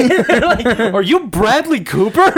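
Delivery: laughing through a high, loud question; amused starstruck cops, half laughing. Retold or imitated. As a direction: giggle out the question. Police recognise the star.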